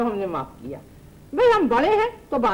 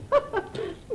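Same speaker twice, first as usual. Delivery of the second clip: short chuckle over the game; a light, high burst of laughter; 10 semitones higher, 6 dB quieter than usual. Acted. Laughs during the board game.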